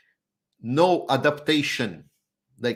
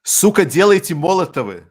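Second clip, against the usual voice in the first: loud, high mock Russian shout; loud Russian-accented demand; 8 semitones higher, 12 dB louder than usual. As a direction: bark it like an angry Russian. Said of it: "say it with Russian accent".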